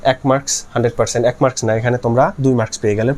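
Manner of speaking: usual speaking voice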